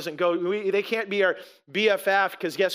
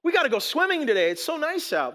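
higher-pitched imitation of an eager kid; lightly hammed-up kid's excitement, pitched up; excited daughter; 6 semitones higher than usual. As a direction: mimic a child pleading to go swimming. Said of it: "my daughter got up, she goes".

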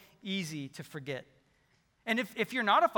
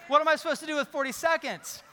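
exasperated extrovert; 7 semitones higher, 5 dB louder than usual. Pretend.

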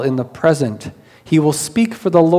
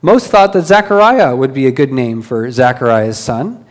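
ordinary delivery

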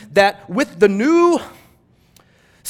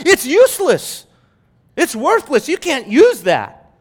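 high, raised-voice condemnation; emphatic dismissal; 6 semitones higher, 5 dB louder than usual. Real, not acted. Preacher rejecting a dirty cup as illustration, emphatically dismissive. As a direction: throw each verdict out high and hard.

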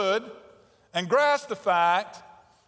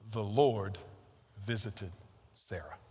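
hushed reading; 11 semitones lower, 9 dB quieter than usual. Shown as a demonstration. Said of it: "There's the point, friends".

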